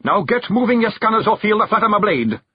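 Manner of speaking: mock gruff command; growled threat. Rob drives the clan off, in a mock growl. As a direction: drive them off.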